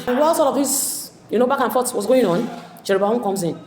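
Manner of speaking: usual speaking voice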